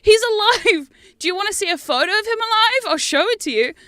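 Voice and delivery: high, loud pleading insistence; high and loud, begging the cat to believe; insistent. Retold or imitated. Owner pleading with her grieving cat, high and loud. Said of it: "And I'm like".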